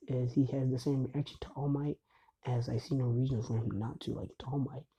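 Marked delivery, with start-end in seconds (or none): none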